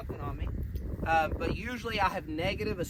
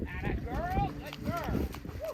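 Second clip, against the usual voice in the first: cheerleader praise; 5 semitones lower, slower than usual. Real, not acted. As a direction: whoop her on like a cheerleader. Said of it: "channel that inner cheerleader".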